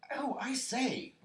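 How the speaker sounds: high, loud, drawn-out piping; Piglet's excited squeak; flustered exclamation. A narrator's reading. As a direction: squeal it in alarm.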